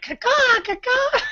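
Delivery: high loud crow caws; bird caw. Retold or imitated. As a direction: squawk like a puppet bird. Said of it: "they do the little noise".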